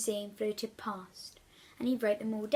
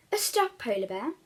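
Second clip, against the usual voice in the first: ordinary delivery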